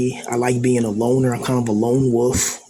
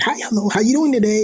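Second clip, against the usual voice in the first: much higher, sing-song customer-service greeting; cheery counter voice; 9 semitones higher, faster than usual. Retold or imitated. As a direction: chirp it like a polite cashier. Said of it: "the way they talk with that accent, they talk with that voice".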